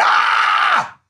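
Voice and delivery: high, drawn-out "ah" yell; retold frustrated scream. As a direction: cry out long and high in mock agony. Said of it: "You went".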